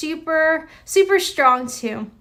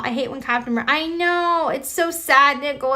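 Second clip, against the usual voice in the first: usual speaking voice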